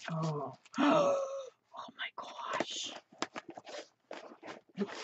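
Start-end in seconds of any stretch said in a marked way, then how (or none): 0.7-1.5 a high, sudden gasp of delight; sharp gasp. Real, not acted. Opening her friend's stuffed envelope, a sharp gasp. 1.8-2.9 breathless whispered wonder; awed whisper. Real, not acted. Pulling a gift from the envelope, an awed whisper.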